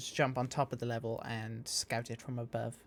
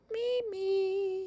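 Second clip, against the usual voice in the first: a much higher, creepy croon on the name. Acted. Villain pining for his sweet Mimi, creepy high voice. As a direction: make the name sound creepy, pitched way up. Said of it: "I wanted to make it creepy".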